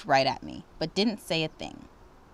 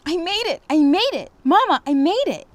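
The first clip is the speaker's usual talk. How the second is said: much higher and louder joyful shout; excited shouting; 12 semitones higher, 9 dB louder than usual. A narrator's reading. Girl shouting to Mama she made the audition, much higher and louder. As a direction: shout it out, bursting with joy. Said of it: "Jumping and shouting".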